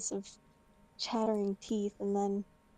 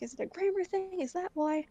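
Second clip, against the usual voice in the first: high-pitched, irritated questioning; retold exasperation, voice pitched up; pissed off; 9 semitones higher than usual. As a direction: needle them with a peeved question. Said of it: "I was pissed off at that point".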